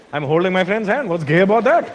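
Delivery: lightly voiced Indian man. Comic voicing Indian men, a hint of innocent surprise. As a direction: shrug it off as obvious.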